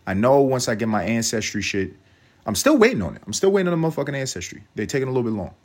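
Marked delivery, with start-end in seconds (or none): 2.4-4.6 fed-up whine; exasperated. Real, not acted. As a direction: complain it's taking forever. Exasperated complaint.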